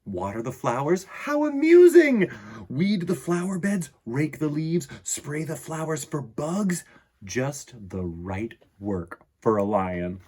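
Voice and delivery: animated read-aloud with lively swings in pitch; children's story narration. A narrator's reading. Reading a picture book about a lion gardener to children, animated storytelling. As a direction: read it to children with playful, varied emphasis.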